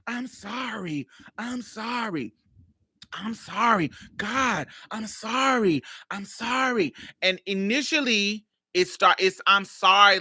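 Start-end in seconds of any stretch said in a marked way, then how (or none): 0.1-6.9 high, drawn-out imitation of a reluctant apology; grudging apology. Friend apologizing to get it over with, grudging. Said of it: "let me just say it and get it over with". 9.5-10.2 higher, loud imitation of a token sorry; perfunctory apology. Friend initially apologizing to get it over with, perfunctory. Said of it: "let me just say it and get it over with".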